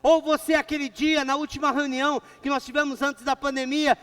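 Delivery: loud, fervent preacher voice; preaching. Acted. Pastor preaching loudly to his church, fervent. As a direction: preach it out to the congregation.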